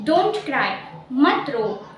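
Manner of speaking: ordinary delivery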